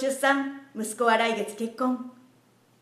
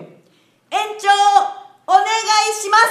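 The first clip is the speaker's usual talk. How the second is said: spaced out, building to loud; loud request; 7 semitones higher, 9 dB louder, much faster than usual. Acted. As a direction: call each piece out louder. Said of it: "もっとはっきり大きな声で".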